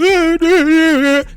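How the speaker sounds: sudden high laugh breaking the rant; amused real laugh cutting into the point; high burst of laughter. Real, not acted. Laughs mid rant about sisters.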